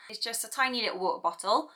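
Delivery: normal talking voice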